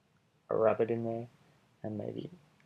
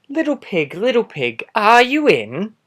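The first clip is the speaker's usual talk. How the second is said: sing-song call, higher and a bit louder; 11 semitones higher, 18 dB louder, slower than usual. Acted. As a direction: call sweetly up to the door. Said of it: "so I called".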